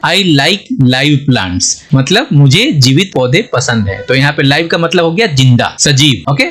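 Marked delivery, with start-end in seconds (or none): 0.1-1.6 very slow, careful dictation; teacher dictating an English example sentence. Shown as a demonstration. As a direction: read it word by word for learners. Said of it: "अब इससे sentence बनाकर देखते हैं".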